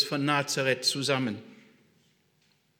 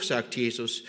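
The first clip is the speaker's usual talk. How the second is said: normal talking voice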